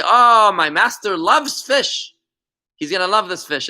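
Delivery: fisherman telling of his master's taste, slow and eager. Retold or imitated. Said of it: "he hears the fisherman say".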